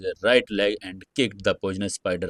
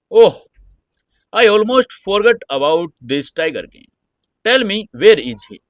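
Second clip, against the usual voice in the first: high, loud mock-casual taunt; amused; 10 semitones higher, 8 dB louder than usual. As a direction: toy with her, bright and loud. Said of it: "Summer smiled and said".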